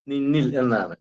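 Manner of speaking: ordinary delivery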